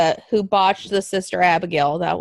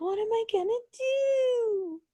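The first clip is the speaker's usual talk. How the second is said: much higher, slow mock despair; lost, helpless whine; 12 semitones higher, 6 dB quieter than usual. Alexa turned away from the funhouse, whining high and slow. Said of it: "she's all lost and like".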